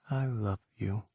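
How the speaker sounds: a tender confession to Ethan, breathed softly. A narrator's reading. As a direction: breathe it out gently. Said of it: "Jack breathed".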